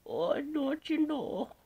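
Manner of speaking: put-on high, slow Upper Midwest accent on "don't you know"; northern accent. Teasing the northerners with a high, slow Midwest accent. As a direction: stretch it out like a mock Wisconsin local. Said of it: "That's for you northerners".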